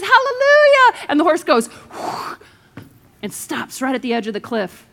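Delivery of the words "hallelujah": much higher, loud, drawn-out hallelujah; desperate shout. Retold or imitated. Rider at the cliff edge shouting the stop word, loud and drawn out. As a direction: yell it as a last resort.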